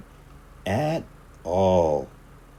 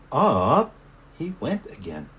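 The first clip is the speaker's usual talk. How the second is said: usual speaking voice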